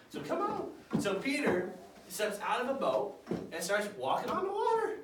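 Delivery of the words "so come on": Jesus beckoning. Acted. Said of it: "We'll pretend I'm Jesus".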